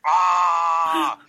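excited fan scream. Retold or imitated. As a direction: re-enact a starstruck yell. Retold excited meeting on stage.

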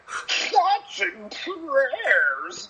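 high, very slow, cheeks puffed; squished cheeks. Retold or imitated. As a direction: talk through fat squeezed cheeks. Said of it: "say that through fatter cheeks".

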